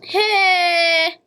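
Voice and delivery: loud high yell; shout. Real, not acted. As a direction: yell out sharply. Shout in game.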